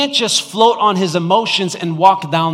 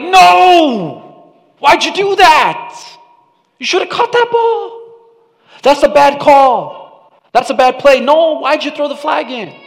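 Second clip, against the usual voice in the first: loud, high yell at the referee; mock fan shouting; 11 semitones higher, 8 dB louder than usual. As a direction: scream at the ref like a furious sports fan. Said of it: "shouted at your Bible".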